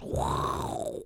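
long airy whoosh; imitated whoosh. Peggy mimics a future going down the toilet, whooshing. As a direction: blow out a toilet-flush whoosh. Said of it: "makes a twirling, uh, motion with her, with her finger and goes".

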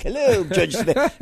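high, slow mock-British aristocrat greeting; posh British aristocrat. Father answering phone in fake British accent. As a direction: put on a Ted Knight British lilt. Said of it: "Sir Max was like this almost British aristocrat".

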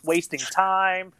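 ordinary talk, nothing marked

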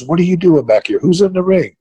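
high, startled questions at the missing wrestler; surprised demand. Retold or imitated. Agent catches a wrestler backstage, startled and demanding.

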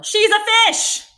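high, loud insistent yell; exasperated shout. Real, not acted. Answering angry fans of Ariel with a loud high yell. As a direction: shout down the critics.

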